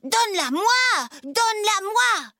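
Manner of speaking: high-pitched tantrum demand; acted bratty yelling, pitched high; stamping child's cry. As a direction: wail it like a kid stamping his feet. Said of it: "Isidore trépigne et crie".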